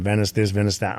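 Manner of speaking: ordinary talk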